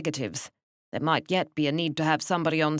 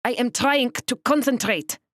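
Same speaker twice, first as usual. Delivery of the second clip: sharp, loud, high German snap; snapping; 8 semitones higher, 5 dB louder than usual. Acted. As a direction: bite their heads off. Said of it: "Missus Koenig snapped at the two of us".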